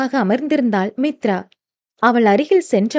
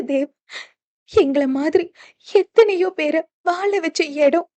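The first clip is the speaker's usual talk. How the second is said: higher, softer tearful pleading; a wife sobbing out her fear, voice high and soft; 5 semitones higher, 3 dB quieter than usual. Acted. Wife crying into her husband's arms, high and soft. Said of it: "என விம்மி விம்மி அழுதவளை".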